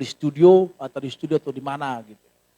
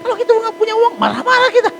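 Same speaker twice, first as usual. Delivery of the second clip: high, loud preaching push; 5 dB louder than usual. Acted. Pastor urging the congregation to pray, high and loud. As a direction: preach it to the back row.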